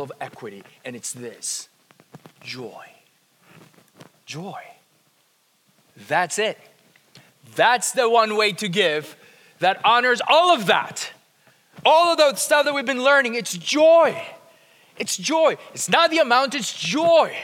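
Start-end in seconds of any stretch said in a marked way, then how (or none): 2.4-4.6 the word joy barely whispered. Real, not acted. 11.8-17.4 emphatic build. Real, not acted.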